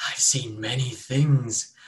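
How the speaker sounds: creepy cultist. Acted. Cultist leaning close, slow and ominous to the holy man. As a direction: lean in and unsettle him.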